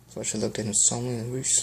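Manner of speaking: plain reading voice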